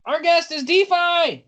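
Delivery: high, excited shout of introduction; sudden high-pitched proclamation; shouted announcement. Real, not acted. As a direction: burst in and announce it loudly. Blurts out the delayed guest introduction.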